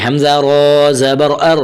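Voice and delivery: slow, measured Arabic letter drill; teacher reciting a Qaida drill slowly; Qaida drill recitation. Shown as a demonstration. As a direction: chant the letters like a Quran teacher.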